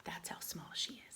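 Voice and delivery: whispered aside. Real, not acted. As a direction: lean in and whisper.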